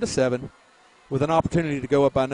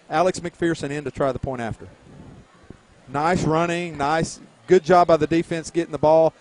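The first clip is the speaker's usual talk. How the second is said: ordinary talk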